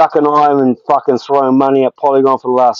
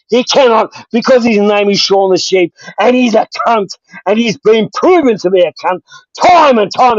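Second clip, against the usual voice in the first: furious rant; 5 semitones higher than usual. Real, not acted. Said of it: "Woo-wee".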